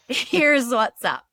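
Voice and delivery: slow and firm, each word laid down; mock assertive. Mock quote, telling people off.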